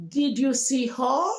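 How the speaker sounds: native-speaker question sweeping up on "her"; native English speaker accent. Shown as a demonstration. Teacher models asking as a native speaker, rising native accent. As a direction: native English intonation, climb high on "her". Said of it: "As a native speaker".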